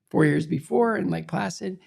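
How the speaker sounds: usual speaking voice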